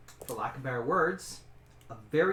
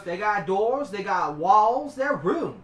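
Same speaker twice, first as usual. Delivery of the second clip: high, loud, stubborn cook; 4 semitones higher, 7 dB louder, slower than usual. Acted. The cook argues with the adventurer about the rooms, high and insistent.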